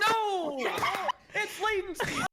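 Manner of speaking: very high squealed outcry; laughing protest. Real, not acted. Player blames latency for a lost buzz, laughing protest. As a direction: cry foul through laughter.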